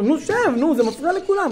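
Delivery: high-pitched, pressured scolding; exasperated parent. Retold or imitated. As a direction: try to take control of the kid with everyone watching. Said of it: "שאני אשתלט עליו". (אבא לחוץ שנוזף בקול גבוה)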